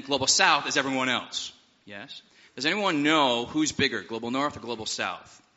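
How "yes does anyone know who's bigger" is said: usual speaking voice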